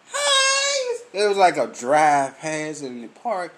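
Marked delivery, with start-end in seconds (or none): 0.1-1.0 calling out. Retold or imitated. Coworkers calling his name from across the street, high call-out. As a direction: sing out a greeting from far away.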